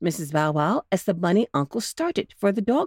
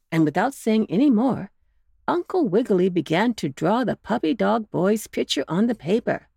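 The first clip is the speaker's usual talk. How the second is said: ordinary delivery, nothing marked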